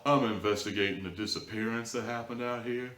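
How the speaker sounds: mocking a cop's slow, soft, smooth southern drawl, a bit dramatic; hushed, buttery southern lawman impression, lightly done; Officer Warren southern drawl. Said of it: "that's basically Officer Warren in a nutshell".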